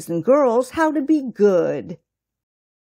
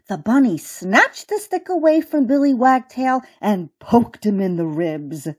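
usual speaking voice

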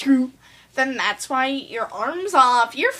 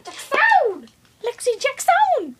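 calling out; 11 semitones higher than usual. Acted. Judge calls out name.

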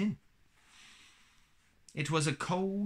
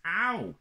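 high, loud "ow"; pained yelp; 10 semitones higher, 6 dB louder than usual. Acted. Eeyore as his tail is pulled, pained yelp. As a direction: yelp from a sudden tug.

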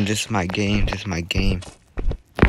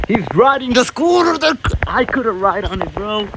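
high-pitched outraged whine; 7 semitones higher than usual. Real, not acted. Annoyed someone took scooter.

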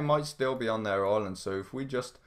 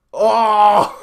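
loud laughing yell; excited shout; 11 semitones higher, 15 dB louder than usual. Real, not acted. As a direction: shout with glee. Hit opponent, laugh shout.

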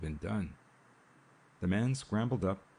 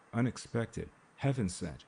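usual speaking voice

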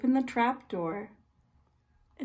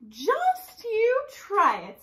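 high, slow dare; a touch of a fierce dare; defiant; 9 semitones higher, slower than usual. Acted. Fierce little woman daring pirate, high and slow. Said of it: "said the woman".

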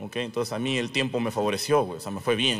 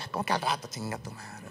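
soft aggrieved comeback, dropping away; indignant echo; 4 semitones lower, 8 dB quieter than usual. Retold or imitated. Rejected boy reacting to the put-off, quietly indignant. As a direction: grumble back at the brush-off under your voice.

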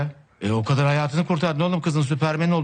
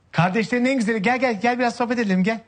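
buttering up; 7 semitones higher than usual. Acted. Buttering up brother for favour.

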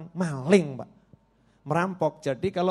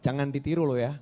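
ordinary talk, nothing marked

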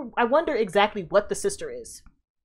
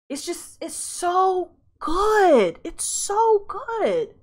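swooning praise; 9 semitones higher, much slower than usual. Real, not acted. Savoring the episode.

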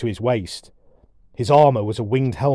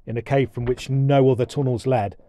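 ordinary delivery, nothing marked

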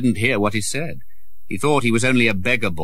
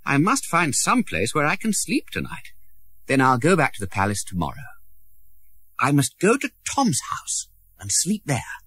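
a touch higher, talking himself into a plan; lightly voiced prince thinking; 5 semitones higher than usual. A narrator's reading. Lost prince planning where to sleep, lightly voiced.

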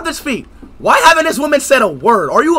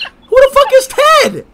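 high, slow, put-on disbelief; exaggerated outrage; 11 semitones higher than usual. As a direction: stretch it out in mock shock. Mock outrage, drawn out.

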